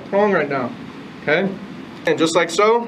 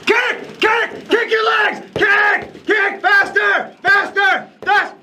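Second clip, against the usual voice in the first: high loud barked commands; shouting commands; 3 dB louder, slower than usual. Real, not acted. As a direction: yell at them to kick faster. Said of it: "instructor coaching drill shouts".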